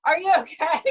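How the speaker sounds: high call across a workshop; imitated yell. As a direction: act out everyone yelling to check on someone. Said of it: "everyone yells".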